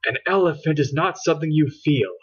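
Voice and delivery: quick, snappish retort; snapped. A narrator's reading. Angry Fran snaps at silly Fran, quick and irritated. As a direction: snap it back with scorn. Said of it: "Angry Fran snapped".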